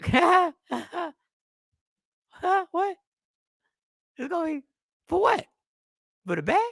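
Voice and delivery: high, squeaky disbelief in short bursts; incredulous reaction. Retold or imitated. Retelling shock at a ten-cent bag charge, incredulous. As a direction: act out a baffled, high-pitched double take.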